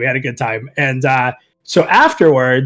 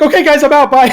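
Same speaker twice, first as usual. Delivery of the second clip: quick bright exit; 9 semitones higher, 6 dB louder, faster than usual. Retold or imitated. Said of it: "And Lexer's like".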